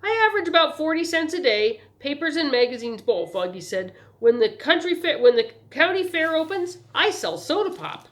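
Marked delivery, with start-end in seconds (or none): 0.0-3.3 put-on higher, louder, proud boy's voice; businesslike young paper seller; eager newsboy. A narrator's reading. Newsboy boasting of earnings. 4.2-8.1 put-on higher, louder, proud boy's voice; businesslike young paper seller; eager newsboy. A narrator's reading. Newsboy boasting.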